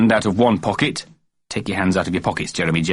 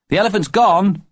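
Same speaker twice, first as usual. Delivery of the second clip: put-on high child voice; little boy voice; 11 semitones higher, 3 dB louder than usual. A narrator's reading. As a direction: speak like an excited small boy. Child character.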